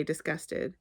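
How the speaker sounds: plain reading voice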